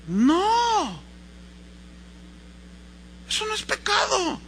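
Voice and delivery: high, drawn-out dismissal; acted priestly verdict; incredulous priest. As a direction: assure the boy with exaggerated emphasis. Priest dismissing the child's sin.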